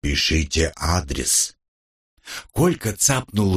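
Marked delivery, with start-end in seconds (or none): 0.0-1.6 low, slow, booming from a barrel. A narrator's reading. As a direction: dictate from inside a metal tank. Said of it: "утробный голос, звучащий словно из бочки".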